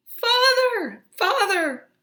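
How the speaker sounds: very high, loud desperate calls; children calling. Acted. Children being carted away calling to Henry. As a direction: cry out for your father.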